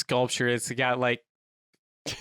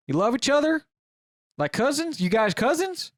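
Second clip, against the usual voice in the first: higher, louder puzzled questions; clueless guy; 10 semitones higher, 4 dB louder than usual. Acted. Clueless man guessing at the couple's relationship, baffled. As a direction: ask it baffled, pitch leaping up.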